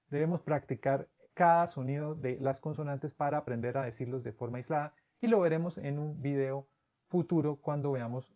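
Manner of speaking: normal talking voice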